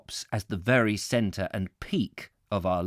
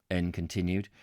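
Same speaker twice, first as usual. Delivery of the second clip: ordinary delivery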